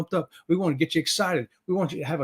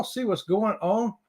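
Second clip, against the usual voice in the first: normal talking voice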